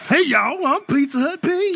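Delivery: very high cheerful mascot greeting; bright squeaky sing-song welcome. Pretend. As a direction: squeak out a jolly mascot hello. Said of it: "worst mascot ever".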